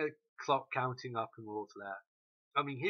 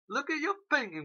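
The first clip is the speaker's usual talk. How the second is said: louder repeat; 13 semitones higher, 4 dB louder than usual. Retold or imitated. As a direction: Look at your painting, yelled much higher and louder.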